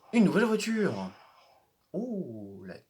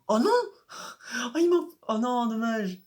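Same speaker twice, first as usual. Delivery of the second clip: high, loud wail of disappointment; real dismay, voice breaking upward; dismayed cry; 9 semitones higher, 4 dB louder than usual. Real, not acted. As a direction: cry out as the car crashes. Crash in the race game.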